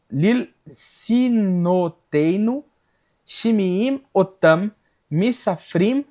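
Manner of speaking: slow, deliberate Hebrew verse reading; measured recital of sacred text in Hebrew; scripture recitation. Real, not acted. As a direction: read out Hebrew scripture word by word, with pauses.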